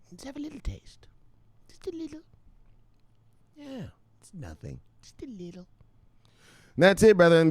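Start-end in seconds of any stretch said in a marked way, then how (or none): 0.1-5.7 soft, higher whispered coaxing; tempting whisper. Acted. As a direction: coax them in a whisper. Dealer tempting softly.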